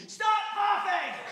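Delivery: high, slow yelled order; mock shout. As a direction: yell it across the store like an annoyed wife. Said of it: "she very lovingly went".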